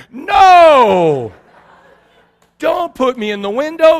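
long, loud, high "no", then pleading; hammed-up inner scream; mock panicked protest. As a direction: cry out in comic dread. His panicked thought.

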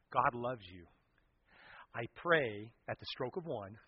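ordinary delivery

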